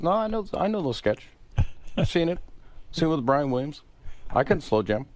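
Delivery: imitating Obama. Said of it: "He goes".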